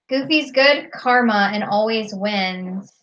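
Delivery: ordinary talk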